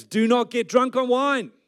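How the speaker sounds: high, loud, slow command; proclaimed scripture. Acted. Preacher reading Paul's command to the church, proclaimed scripture. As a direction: declaim it slowly from the pulpit.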